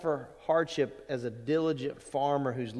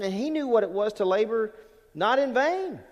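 building preaching; 7 semitones higher, 4 dB louder than usual. Real, not acted.

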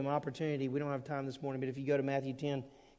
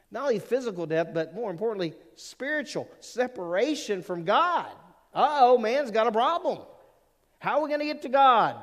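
rising, louder preaching climax; preaching build; 7 semitones higher, 7 dB louder than usual. Acted. Preacher building to the congregation, louder and louder. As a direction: build the sermon to full force.

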